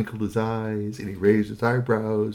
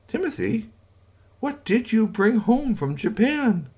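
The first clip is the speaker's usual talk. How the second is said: very high, slow quizzing; high suspicious wife; 12 semitones higher, slower than usual. Acted. Wife quizzing her husband, very high and slow. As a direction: ask him what on earth he brought.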